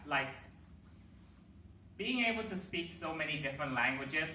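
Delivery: ordinary talk, nothing marked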